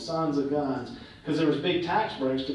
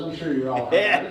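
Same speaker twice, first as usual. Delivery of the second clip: joking irony; 4 dB louder, much faster than usual. Real, not acted. Joking about obscure award.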